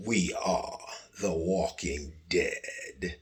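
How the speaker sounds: slow, soft, dramatic title drop; ominous title read. Acted. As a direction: let the show name hang in the dark. Show title, dramatic delivery.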